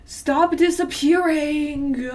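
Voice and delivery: loud, high, drawn-out yell of annoyance; stretched-out cry of frustration; exasperated shout. Real, not acted. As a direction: yell it slowly, fed up. Exasperated outburst while reading.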